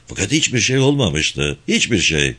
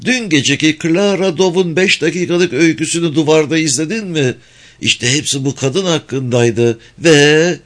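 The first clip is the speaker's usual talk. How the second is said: Mildred; 6 semitones higher than usual. A narrator's reading. Mildred gossips.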